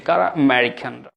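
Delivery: ordinary delivery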